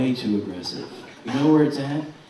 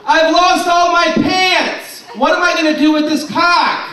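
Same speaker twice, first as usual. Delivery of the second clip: hammed-up plot line; 13 dB louder than usual. Eighties film actor who lost his pants, hammed-up shout. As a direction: wail it like terrible over-acting. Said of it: "fun little plot lines like".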